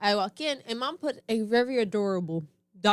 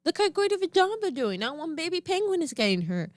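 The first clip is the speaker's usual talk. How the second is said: coaxing big sister; 7 semitones higher than usual. Retold or imitated. Big sister soothing toddler about penguins, high upbeat coaxing. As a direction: talk a scared child round with praise. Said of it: "I was like".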